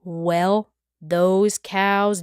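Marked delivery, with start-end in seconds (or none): none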